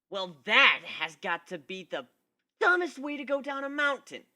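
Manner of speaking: a hint of a gruff, cocky griffin; lightly voiced Gilda. A narrator's reading. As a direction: tough tomboy griffin scolding. Gilda mocking him.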